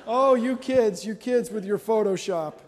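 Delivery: wag a finger at the pranksters, playfully loud; mock scolding. Mock scolding the April fool pranksters.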